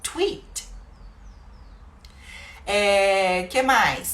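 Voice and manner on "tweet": a much higher, breathy tweet; bird tweet. Shown as a demonstration. Teacher showing the English bird sound, chirped. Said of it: "ele fala tweet".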